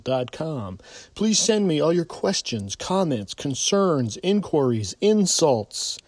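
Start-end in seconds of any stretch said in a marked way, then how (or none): none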